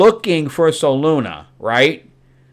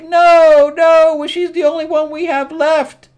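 very high pleading voice; parents' voice; 7 dB louder than usual. Retold or imitated. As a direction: beg them, high and loud. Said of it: "And they're like".

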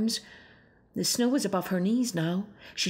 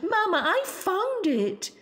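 joyful shout; 11 semitones higher than usual. Acted. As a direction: shout the good news. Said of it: "Irene shouted".

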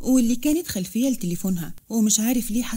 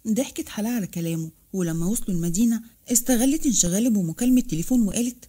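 ordinary delivery

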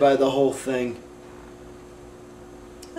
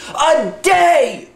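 outraged shout; 10 semitones higher than usual. Acted. Ranting to the room about war costs, outraged shout. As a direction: shout it like you're losing your mind.

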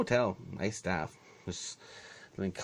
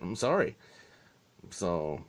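usual speaking voice

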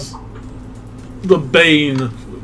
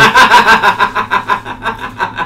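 loud laugh; 8 semitones higher, 5 dB louder than usual. Real, not acted. Friend laughing hard at the diva joke, loud laugh. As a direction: burst of loud, high laughter.

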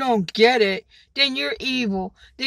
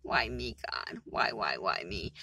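hushed, sinking lower, whispered lament; a whispered, ever lower why me; whispered despair; 13 semitones lower, 10 dB quieter than usual. Retold or imitated. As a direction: breathe it out like a worn-out plea to God. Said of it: "I'm just like".

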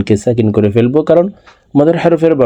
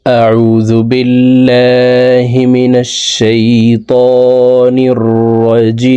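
slow, drawn-out chanted recitation; Quran recitation; much slower than usual. Shown as a demonstration. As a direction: recite it slowly with tajweed.

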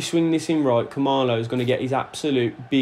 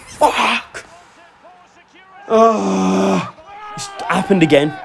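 frustrated outcry; 5 semitones higher, slower than usual. Real, not acted. Conceding again in the match.

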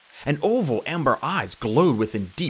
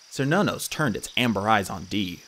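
ordinary delivery, nothing marked

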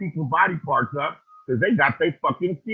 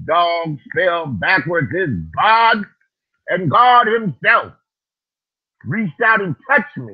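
preaching; 5 dB louder, slower than usual. Acted. Preacher testifying to his listeners, loud and slow. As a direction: stretch it out like a revival sermon.

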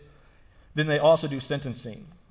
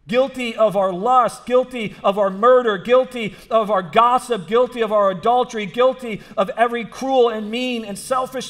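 raised, emphatic sermon voice; higher-pitched, insistent pulpit delivery; impassioned preaching; 7 semitones higher, 6 dB louder than usual. Real, not acted. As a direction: preach it with force, driving each phrase home. Preacher builds from story into sermon.

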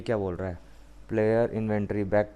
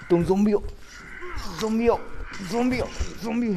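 mocking squeal; 11 semitones higher, much faster than usual. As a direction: taunt the zombie in a shrill mock squeal. Mocking a zombie, then laughs. (ऊँची आवाज़ में चिढ़ाते हुए)

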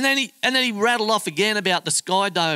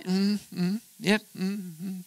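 soft noncommittal mm sounds; polite murmurs; 6 dB quieter, slower than usual. Retold or imitated. Nodding along to a ranting stranger, soft mms. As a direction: humour him quietly with mms. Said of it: "we're sort of like".

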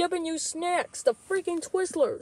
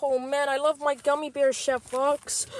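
normal talking voice

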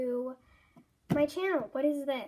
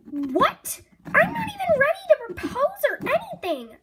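shocked outburst; 12 semitones higher, 6 dB louder than usual. Acted. Ginger shocked by secret wedding.

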